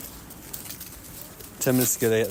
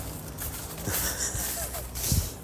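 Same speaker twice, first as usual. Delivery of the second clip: squeaky high laugh; 10 dB quieter than usual. Real, not acted. Laughing at own joke.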